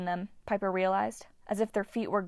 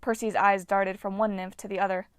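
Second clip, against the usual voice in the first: ordinary delivery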